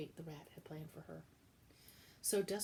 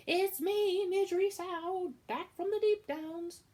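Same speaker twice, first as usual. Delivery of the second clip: high, loud and slow call across the kitchen; shouted greeting; 14 semitones higher, 12 dB louder, slower than usual. Acted. Midge shouting to Cook on her return, loud and high. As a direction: belt it out, much higher and louder. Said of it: "She shouted".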